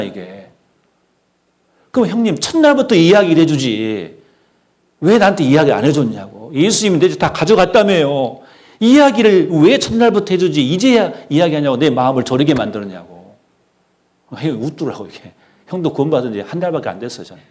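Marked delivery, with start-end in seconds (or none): 1.9-13.4 loud, high-pitched mock protest; acting out a heated grievance; retold indignant complaint. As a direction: berate an older brother in aggrieved exasperation. Retells complaining to his brother.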